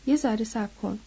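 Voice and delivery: usual speaking voice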